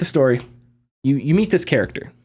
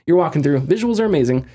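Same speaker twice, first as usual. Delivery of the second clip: ordinary delivery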